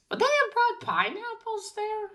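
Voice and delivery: very high, incredulous question; genuinely baffled, high-pitched musing; puzzled questioning. Real, not acted. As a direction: let the pitch soar as you wonder. Still puzzling over the pineapples.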